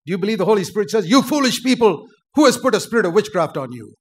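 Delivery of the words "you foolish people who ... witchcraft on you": high and loud, scolding; loud, indignant pulpit challenge; forceful preacher's rebuke. Acted. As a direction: rebuke the congregation hard. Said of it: "we need more spirit-filled preachers like that".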